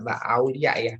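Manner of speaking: ordinary talk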